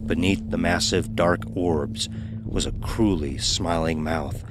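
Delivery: usual speaking voice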